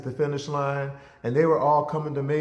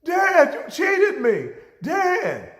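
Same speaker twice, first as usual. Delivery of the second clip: put-on high, loud boyish cry of protest; boy protesting to his father after the race, high shout; upset kid shout; 6 dB louder, much slower than usual. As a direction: yell at dad like a cheated boy. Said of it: "And I was like".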